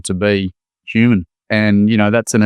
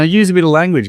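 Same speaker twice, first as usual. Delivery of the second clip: normal talking voice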